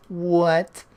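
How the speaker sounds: high, loud, disbelieving "what"; a startled yelp of a question; shocked. Real, not acted. Startled reaction to game message.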